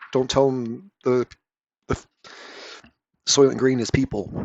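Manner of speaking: lightly hammed-up sci-fi revelation; Soylent Green energy. Reviewer mocking a comic's big secret, mock-dramatic. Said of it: "sort of energy".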